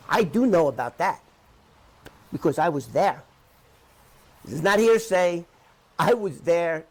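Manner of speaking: loud, firm insistence; emphatic. Real, not acted. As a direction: hammer it home that you were there. Insisting he witnessed it firsthand.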